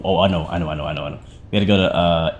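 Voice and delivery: normal talking voice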